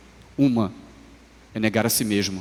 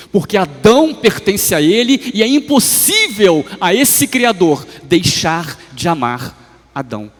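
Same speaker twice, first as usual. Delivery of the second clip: impassioned preaching; 7 semitones higher, 8 dB louder than usual. Real, not acted. Preacher rises to a climax.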